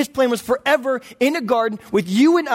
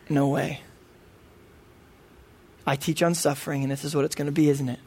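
quiet dread; 5 semitones lower, 5 dB quieter than usual. Retold or imitated. Inner dread, baby not moving.

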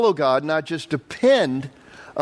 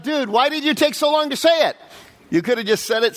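mock exasperation; 9 semitones higher than usual.

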